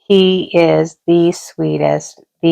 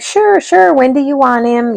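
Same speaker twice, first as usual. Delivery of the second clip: much higher, louder, bubbly yes-yes; eager agreement; 9 semitones higher, 4 dB louder than usual. Retold or imitated. Friend Rebecca eagerly agreeing to bring the puppy, high excited gush. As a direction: gush like someone thrilled to hand over the puppy.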